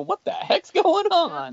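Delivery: high, slow, stunned disbelief; drawn out like you can't believe your eyes; high, slow disbelief at the driver behind; baffled shock. Retold or imitated. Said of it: "You know".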